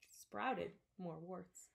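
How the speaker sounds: plain reading voice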